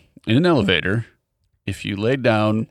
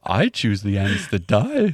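slow put-on bear voice; 2 semitones lower, slower than usual. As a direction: drag it out like a lumbering bear. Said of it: "in a bear voice".

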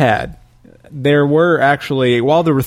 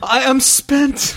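hammy stage actor; 11 semitones higher, much slower than usual. Pretend. Mock dying Shakespearean actor.

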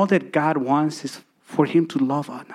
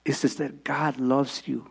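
tender altar call; 3 semitones lower than usual. Acted. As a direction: draw them in gently, almost whispering. Quiet altar call, softening to a whisper.

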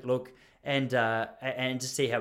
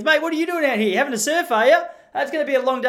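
much higher and louder, hammed-up shout across the water; mocking call-out; 12 semitones higher, 8 dB louder than usual. Snorkeling dad shouting at the clueless surfer, mock yell. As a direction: yell the tease like a cheeky Aussie dad.

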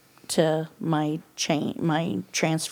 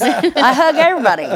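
laughing; 8 semitones higher, 8 dB louder than usual. Real, not acted. Laughing at herself.

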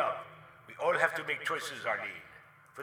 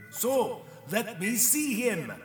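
raised, slow, firm demand; insistent demand; 7 semitones higher, 8 dB louder, much slower than usual. Acted. Visitor to nurse, demanding loudly. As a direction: push past the nurse, insisting.

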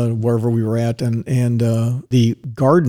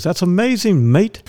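lightly imitated Irish-Scottish lilt, pitched higher; a waiter's mild Irish-Scottish accent, retold; 7 semitones higher than usual. Waiter answers his guessing with a hint of Irish-Scottish accent. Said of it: "he's got this accent".

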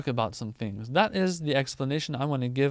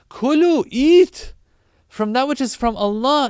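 Kulu and eat hit much higher and louder; emphatic command; 8 semitones higher, 10 dB louder than usual. Acted. Preacher driving home the verse's command to eat, much higher and louder. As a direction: punch Kulu and eat like a proclamation.

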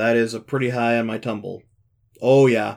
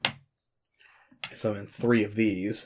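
grand, slow incantation; slow dramatic summoning; 7 dB quieter, much slower than usual. Acted. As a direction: draw out the summoning words.

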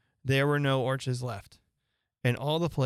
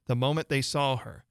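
plain reading voice

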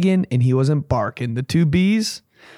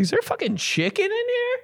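high-pitched mock bewilderment; baffled; 4 dB quieter than usual. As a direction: ask it high, as if utterly puzzled. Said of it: "I was like".

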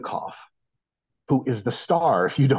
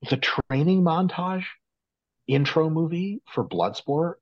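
usual speaking voice

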